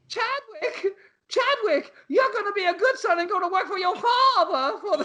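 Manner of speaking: high, hammed-up Southern belle accent. Mother urging Chadwick to join the pineapple company, high Southern belle accent. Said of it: "You gotta be a Southern belle".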